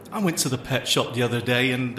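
normal talking voice

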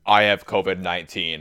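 low-pitched mocking quote of the roommate; mock imitation. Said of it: "I didn't say it like that".

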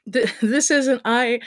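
high, slow, halting stammer; acted flustered stumbling. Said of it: "Melissa stammered as she tried to find the words".

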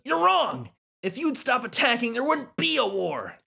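high-pitched protest, shouted; angry shout. Acted. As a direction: yell it back through the bars. Prisoner protests, voiced shouting.

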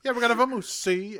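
high mock mobster voice; thirties gangster. Co-host echoes the villain impression, hammed-up gangster voice. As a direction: echo it like an old-time gangster. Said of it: "talk like a nineteen thirties gangster".

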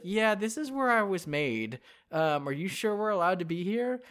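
lightly voiced boy robot, unsure; a hint of a nervous kid; lightly voiced young boy asking permission. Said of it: "he's like".

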